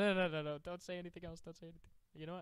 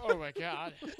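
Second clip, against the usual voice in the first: laughing; 5 semitones higher, 6 dB louder than usual. Real, not acted. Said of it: "you shouldn't have made me laugh".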